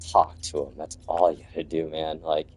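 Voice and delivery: choked up, words fading quiet; holding back tears as the voice breaks; teary voice. Real, not acted. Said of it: "Got a little teary right now".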